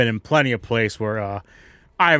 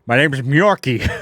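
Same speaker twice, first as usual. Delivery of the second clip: a light Boston twang, slow on Myorki; orc Boston accent; 5 dB louder than usual. Acted. Orc bartender insisting on his name in a light Boston accent. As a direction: drawl out Myorki in Boston style. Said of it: "not with this accent, it ain't".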